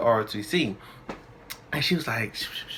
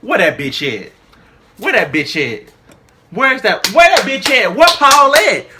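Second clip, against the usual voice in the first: starts flat, climbs much higher and louder; furious building rant; 9 semitones higher, 8 dB louder than usual. Retold or imitated. Retelling her rage at Paul, building fury. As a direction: build from mutter to raging demand. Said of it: "That's all I was in my head".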